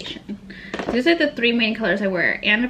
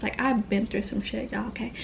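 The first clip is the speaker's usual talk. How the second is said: drops to a secret whisper, voice gone; whispered aside; 6 dB quieter than usual. Real, not acted. Vlogger confiding her rough past to viewers, whispered. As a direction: let it slip out under your breath like a confession.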